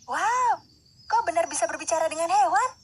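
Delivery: high-pitched wonder; excited princess marvelling; amazed. Acted. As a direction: gasp it out high, truly impressed. Amazed at talking with animals.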